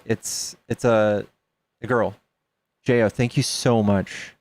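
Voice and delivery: ordinary talk, nothing marked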